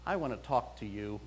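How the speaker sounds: a hint of a solemn toddler voice; serious little girl. Pastor imitates a serious three-year-old girl approaching him. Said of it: "looks at me real serious".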